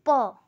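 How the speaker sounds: "प" said as a crisp tense p; teacher models the tense "प" sound; pronounced P. Shown as a demonstration. Teacher showing students the doubled consonant, a firm tense p. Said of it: "ये कैसे pronounce होगा".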